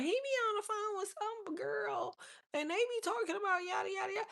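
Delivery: put-on a child telling on him in a small high voice; tattling little boy. A little boy tattling to his mama in a high, soft voice. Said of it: "went and told the mama".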